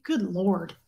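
ordinary talk, nothing marked

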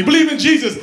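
high mock street preacher. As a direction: yell it like a pushy preacher. Street preacher voiced in bit.

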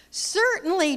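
a high bright chirp of certainty. Acted. Friendly soul chirping to the little soul, high and light. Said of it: "chirped the friendly soul".

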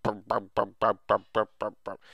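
mock heavy boot steps said as burp; clunky footsteps. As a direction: imitate stomping boots in rhythm. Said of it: "everywhere I walked, it was like".

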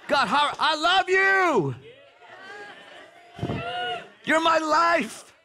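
high, slow, full-voiced cry of love to God; passionate shout. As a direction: pour your whole heart out, shouting it. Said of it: "That's really, no".

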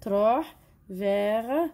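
drawn out slowly for pupils; slow teacher's explanation; slow explaining. Shown as a demonstration. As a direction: say it slowly so the kids follow. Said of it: "وين تروح؟".

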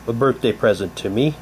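normal talking voice

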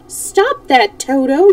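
calling out. A narrator's reading. Dorothy calls Toto out of the hole, calling sharply. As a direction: call out sharply, pitched well up. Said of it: "she called".

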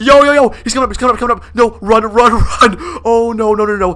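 high, loud frantic alarm; playful panic. Real, not acted. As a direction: panic and scramble to run. Man coming up, must run.

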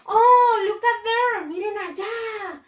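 high, loud gasp of discovery; excited exclamation. Acted. Teacher acting out spotting a cat for pupils, excited exclamation. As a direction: call out as if you just spotted something.

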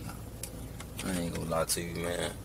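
ordinary talk, nothing marked

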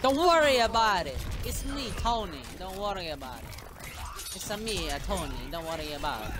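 put-on Mario-style Italian accent; hammy Italian mobster reassurance. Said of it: "I became a mix of an Italian mobster and Mario".

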